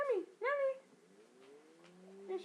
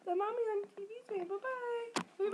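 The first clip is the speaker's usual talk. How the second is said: normal talking voice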